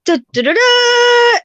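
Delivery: high, loud power-up noises; kid powering up. Retold or imitated. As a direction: chant like a superhero powering up. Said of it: "he's like".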